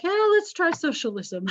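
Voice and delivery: high-pitched, playful mock eagerness; mock enthusiasm. Teacher voices Americans trying something new, mock-eager. Acting out a naive public's reaction.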